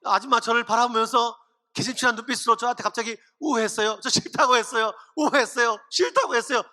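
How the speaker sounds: mock foreign-worker accent in Korean, choppy short sentences; foreigner's broken Korean. Joseph testifies at an Egyptian church, mock foreigner accent in Korean. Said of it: "제가 요셉이라면 이렇게 간증하겠어요".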